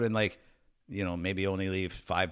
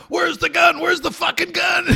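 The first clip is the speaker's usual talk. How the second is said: high, loud police shout; mock yelling; 9 dB louder than usual. Armed cops yelling at a man during a raid, mock shouting. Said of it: "yelling at him".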